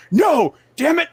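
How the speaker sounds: indignant orc. Retold or imitated. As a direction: protest in a squeaky huff. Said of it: "the one orc's like".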